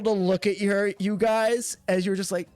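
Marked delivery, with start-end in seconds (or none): none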